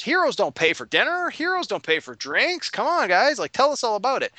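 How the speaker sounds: a merry host waving the heroes in; jolly innkeeper. Retold or imitated. Innkeeper Bertram welcoming the heroes, hearty high voice. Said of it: "Bertram was like".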